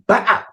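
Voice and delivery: sudden high-pitched punch noise; imitated punch sound. Storyteller acting out the punch that banks on him, sudden high imitated hit. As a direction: pop out a quick high impact sound.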